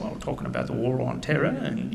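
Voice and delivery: put-on a touch of a British accent; light British accent. Retelling the British contractor's words in a lightly imitated British accent. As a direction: quote the British contractor in his own accent. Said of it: "of course, he was saying".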